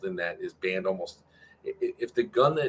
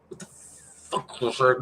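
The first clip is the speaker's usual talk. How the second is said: put-on voice; 4 semitones higher, slower than usual. Reacting to own voice.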